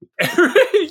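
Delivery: bright rising laugh burst. Real, not acted. Laughing at his co-host's New Yorkers joke, a quick rising laugh.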